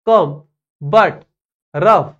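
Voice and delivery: कम, but, रफ each cut short; one short burst each for कम, but, रफ; very short vowel. Shown as a demonstration. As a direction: punch out कम, but, रफ with a quick "uh". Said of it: "एकदम short आणि एकदम छोटं".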